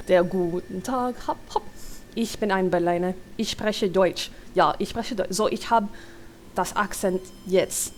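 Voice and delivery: playing around in German; warming up a hammed German accent. Reader practising for a character's lines, German accent. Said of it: "I'm going Schwarzenegger somehow".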